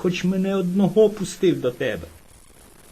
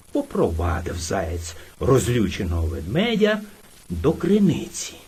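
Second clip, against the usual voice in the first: plain reading voice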